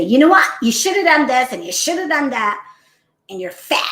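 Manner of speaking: loud, high mock berating of a child; harsh scolding. Woman mimicking a harsh scolding of a young child. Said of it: "would you speak that way to her or him".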